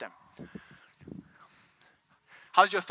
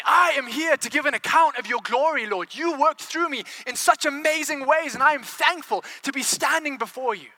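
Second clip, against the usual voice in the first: raised, proud proclamation to the Lord; bold thankful declaration; 4 semitones higher, 3 dB louder than usual. Acted. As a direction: declare it gladly, pitched up and full-voiced. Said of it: "Or would you say".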